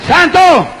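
high shouted preaching in driving repetitions; full-force preaching. Real, not acted. Preacher rallying believers against the devil's threats, high full-force shouts. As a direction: hammer each phrase out to the crowd.

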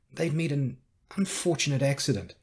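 usual speaking voice

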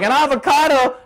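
a junk food eater mocking the avocado tip, incredulous. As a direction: scoff like the idea is absurd. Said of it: "they're like".

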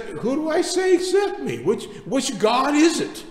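high, loud, insistent questioning; acted-out pleading challenge, pitched well up; protesting. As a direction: press the question as a worried messenger would. Acts out Moses questioning God.

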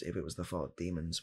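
ordinary delivery

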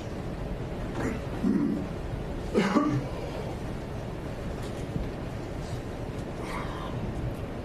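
dry harsh coughs; real coughing fit mid-testimony. Real, not acted. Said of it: "broke into a coughing fit".

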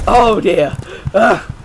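high, loud dismay; alarmed. Real, not acted. Gamer under attack, alarmed. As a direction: cry out in dismay.

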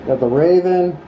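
normal talking voice

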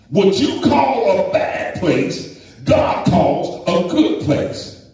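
rising fervent sermon cadence; full-force preaching. Acted. Preacher to congregation, fervent preaching. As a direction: preach it out, building the fire.

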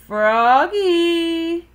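high, loud, long-held call; dad yelling. A narrator's reading. Dad yells at Froggy over the noise, yelling. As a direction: yell the child's name through the house. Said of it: "yelled Dad".

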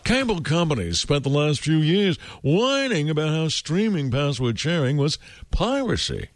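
grand, self-important reading, laughing; pompous voice. Shown as a demonstration. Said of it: "pompous voice".

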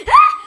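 sharp loud scream of fright; real startled shriek. Real, not acted. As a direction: shriek at the jump scare. Jump scare.

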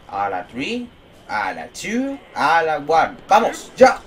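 rising countdown to a shout; countdown hype. Real, not acted. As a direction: build up to a yelled go. Counting down to a jump. (cuenta regresiva que sube hasta un grito)

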